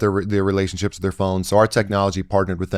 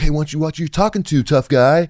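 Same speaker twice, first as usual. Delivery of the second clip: higher-pitched mock challenge; popping back; 6 semitones higher than usual. Traveller retelling his retort to a stranger at the airport, mock confrontational. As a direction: fire back like a would-be tough guy. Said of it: "So I popped back at him".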